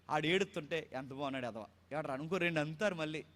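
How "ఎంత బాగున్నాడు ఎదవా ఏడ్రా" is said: hushed, much softer taunt "ఏడ్రా ఎదవా"; mocking tease. Adult teasing a crying child, soft mocking coo.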